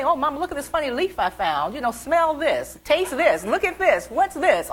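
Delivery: put-on high, insistent little-kid chatter; pestering child. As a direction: pester Mom with one find after another. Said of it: "I would just go interrupt her all during the day".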